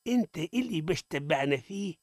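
ordinary delivery

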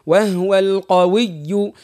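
slow وهو القوي with doubled و in وهو; slow teacher demo of the waw shaddah. Shown as a demonstration. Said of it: "كيف كيف الواو".

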